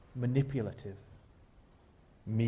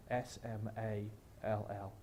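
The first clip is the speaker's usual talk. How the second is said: spelled out slowly; 4 semitones lower, 14 dB quieter than usual. Shown as a demonstration. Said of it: "finalize the small".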